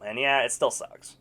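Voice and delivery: ordinary talk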